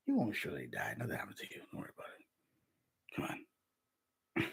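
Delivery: soft whispered serpent coaxing; tempter's whisper. Acted. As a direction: coax them into sin in a hush.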